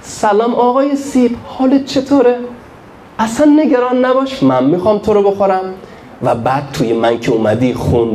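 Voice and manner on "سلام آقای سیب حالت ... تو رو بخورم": higher, gentle coaxing; talking to the apple. Retold or imitated. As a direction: sweet-talk the apple. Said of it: "شروع کرد حرف زدن با این سیبه".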